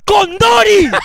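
high, loud name call; yelled name. Real, not acted. Streamer calling out the donor's surname, loud and high.

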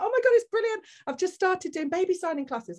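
put-on high, loud, bubbly children's presenter voice; kids' TV presenter. New mum faking cheer to the health visitor, high and bubbly. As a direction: put on a big bright act of being fine. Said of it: "I was like a C B B presenter myself".

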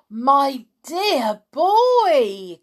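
high, loud, hugely drawn-out exclamation; rapturous drawn-out cry; excited cry. A narrator's reading. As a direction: cry it out grandly, stretching every word. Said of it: "cried Mister Killycranky".

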